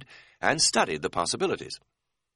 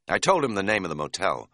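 usual speaking voice

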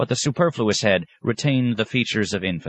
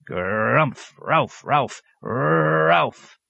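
imitated dog's gruff barks, rising in pitch; mock dog barking, climbing higher; 9 semitones higher, much slower than usual. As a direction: bark like a cranky dog.

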